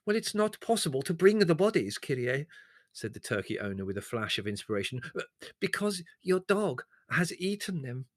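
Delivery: whining villager. Acted. The servile peasant complains to Leslie: high wheedling whine. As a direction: plead your loss with fawning humility.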